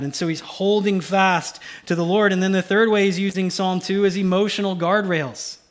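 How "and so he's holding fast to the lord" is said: normal talking voice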